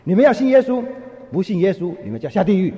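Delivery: shouted warning, loudest at the start, then easing; imitating the young preacher shouting a second time. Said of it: "他再说一次".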